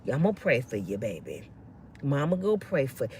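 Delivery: a soothing mother promising prayer; mama's reply. Retold or imitated.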